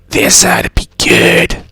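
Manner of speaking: slow, raspy villainess voice; Vidia voice. Acted. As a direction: drag it out in a rasping character voice. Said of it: "it's my video voice".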